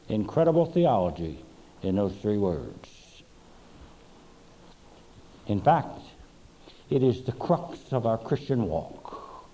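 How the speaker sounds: ordinary talk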